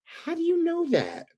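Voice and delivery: high, astonished mock squeal; sky-high disbelieving wife voice; amazed wife. Wife at the TV, amazed he knew: high squeal. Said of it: "and again".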